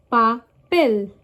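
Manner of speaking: papel split up, emphatic PEL; last syllable stressed. Shown as a demonstration. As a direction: lift and lean on PEL: pa-PEL. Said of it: "last syllable".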